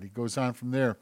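usual speaking voice

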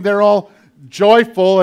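ordinary talk

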